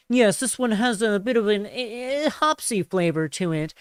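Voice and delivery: lightly put-on snobby beer-nerd voice; a hint of a pretentious brewery hipster; snooty hipster brewer. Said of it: "hipsters that work at breweries and that talk about".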